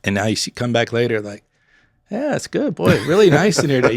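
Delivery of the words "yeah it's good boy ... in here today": high, pleased satisfied customer; content diner. Retold or imitated. Diner after getting bread, pleased and upbeat. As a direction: sound fed and happy now. Fed customers now happy.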